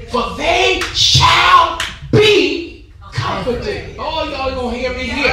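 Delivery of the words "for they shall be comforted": slow, drawn-out preached verse; preached scripture. Acted.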